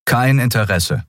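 steady, firm refusal. A narrator's reading. Kai refuses the dealer, firm-voiced. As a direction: turn it down without wavering. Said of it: "sagte Kai mit fester Stimme".

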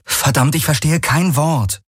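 high-pitched frustrated outburst; exasperated. Acted. Kirai frustrated at not understanding.

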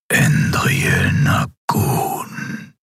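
very slow, drawn-out whisper; ghostly murmur. A narrator's reading. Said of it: "murmelte er. Es klang wie das Flüstern von Gespenstern".

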